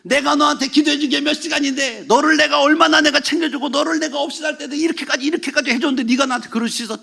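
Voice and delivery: imitating a wounded, angry pastor; hurt anger. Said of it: "화가 나고 막 열받고".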